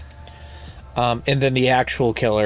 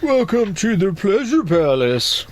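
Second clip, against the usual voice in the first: high, slow sleazy welcome; sleazy host voice; 9 semitones higher than usual. Retold or imitated. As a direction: play a creepy seducer, drawn out. Quoting creepy guy from film.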